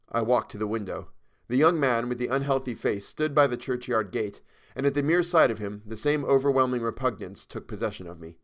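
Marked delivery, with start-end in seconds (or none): none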